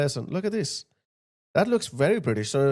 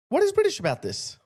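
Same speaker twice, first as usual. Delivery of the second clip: incredulous; 13 semitones higher than usual. Shown as a demonstration. Host repeats his question to viewers, higher and incredulous. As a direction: pitch jumps much higher in disbelief.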